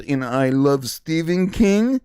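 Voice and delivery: slow put-on Russian accent; drawn-out mock Russian bot. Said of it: "speak with a Russian accent".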